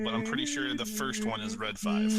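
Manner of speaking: ordinary delivery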